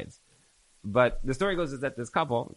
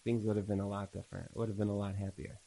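a touch of tearful softness, softer and lower; lightly voiced old wife, quiet and regretful; grandmother's regret; 4 semitones lower, 7 dB quieter than usual. Grandmother answering her husband after the class, softly regretful. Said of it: "And she said".

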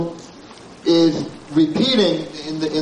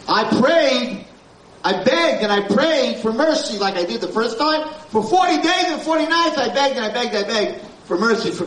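pleading Moshe; 6 semitones higher than usual. Acted. Moshe pleading for forty days.

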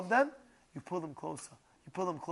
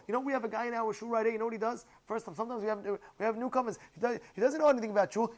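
usual speaking voice

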